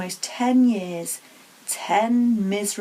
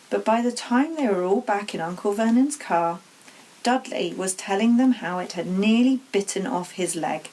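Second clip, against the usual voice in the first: plain reading voice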